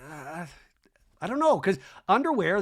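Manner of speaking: normal talking voice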